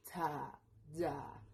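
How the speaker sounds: "ta, ya" as two separate open syllables; teacher slowly modelling "ta, ya"; pronunciation demo. Shown as a demonstration. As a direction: sound out "ta", pause, then "ya". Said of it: "y se pronuncia".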